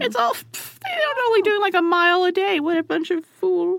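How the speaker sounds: high scoffing German soldier; mocking Germans. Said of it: "makes the Germans think".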